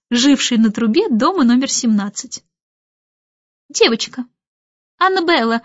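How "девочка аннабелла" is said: short clipped answer, words kept to a minimum; curt reply. A narrator's reading. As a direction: answer briefly, no fuss. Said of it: "кратко отозвалась Мэри Поппинс".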